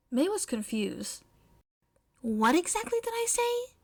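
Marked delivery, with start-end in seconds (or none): 2.2-3.7 puzzled May. Acted. May's confused question.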